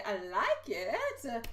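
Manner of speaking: normal talking voice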